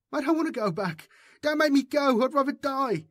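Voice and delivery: very high tearful begging; desperate pleading. A narrator's reading. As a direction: plead not to be sent back. Boy pleading in tears.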